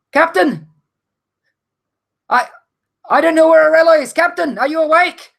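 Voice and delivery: high, loud deckhand rousing the captain; loud calling out. Acted. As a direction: shout urgently to wake someone. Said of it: "She's calling out quite loudly".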